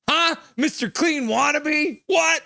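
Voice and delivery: very high, shouted taunting challenge; mock confrontation. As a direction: yell it back in mock outrage. Mock confrontation with threatening viewer.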